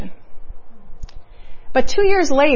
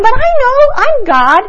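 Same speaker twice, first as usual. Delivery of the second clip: God's voice, much higher and louder, proclaiming; voicing God; 12 semitones higher, 6 dB louder than usual. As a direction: lift it up as God declaring himself. Speaker voices God's reassurance.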